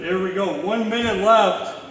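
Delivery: rally yourself for the last minute; hyped shout. Real, not acted. Pumping himself up mid-workout.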